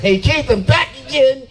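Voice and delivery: high-pitched taunting call; mock heckler. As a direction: imitate a heckler calling to the stage. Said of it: "heckling me".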